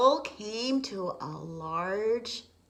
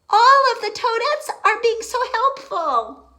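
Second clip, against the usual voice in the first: excited; 10 dB louder than usual. Real, not acted. Excited aside to child.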